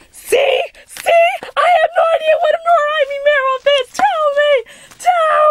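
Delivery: very high, frantic shouted insistence; frantic shouting. Acted. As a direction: yell it in a shrieking panic. Acted outburst in werewolf argument.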